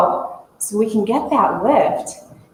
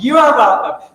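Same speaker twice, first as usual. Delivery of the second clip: higher, louder mock pride; smug joking take on a compliment; mock proud; 5 semitones higher, 6 dB louder than usual. Answering the guide's dig at them, mock proud. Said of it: "And I was like".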